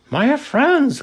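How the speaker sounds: high, eager cry of relief; cried out. A narrator's reading. Horton calls to his found friends, cried out. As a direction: call out gladly to lost friends. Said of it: "cried the elephant".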